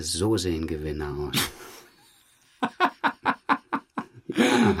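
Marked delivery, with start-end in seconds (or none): 2.6-4.0 a burst of high laughter; bright amused laugh. Real, not acted. Amused by own story. 4.3-4.8 a yes said through a laugh. Real, not acted. Laughing at himself.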